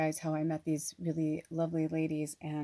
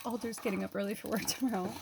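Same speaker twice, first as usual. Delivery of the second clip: normal talking voice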